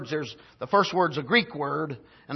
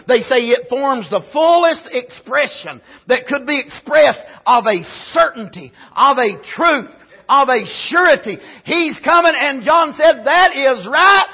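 loud, high preacher's shout; preaching at full force; 6 semitones higher, 9 dB louder than usual. Acted. Pastor condemning the world's mentality, loud preaching. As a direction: hammer the point home to the church.

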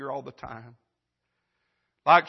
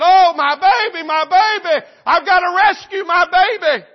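high, loud desperate shout; panicked mother; 13 semitones higher, 14 dB louder than usual. Acted. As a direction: cry out frantically for your child, acted at full force. Preacher acts panicked mother at fire.

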